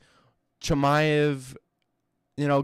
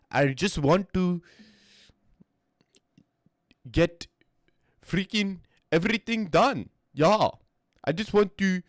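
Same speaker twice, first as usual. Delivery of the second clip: put-on South African accent; 4 semitones higher than usual. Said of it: "it is an S A accent".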